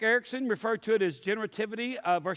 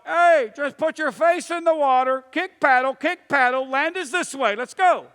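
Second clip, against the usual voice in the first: loud, high, drawn-out commands; bullhorn shouting; 6 semitones higher, 10 dB louder than usual. Acted. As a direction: shout instructions across the water through a bullhorn. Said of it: "you got a bullhorn going".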